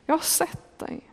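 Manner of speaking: soft murmur. Acted. Jesus softly repeating his reassurance to Natanael. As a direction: let the words barely carry.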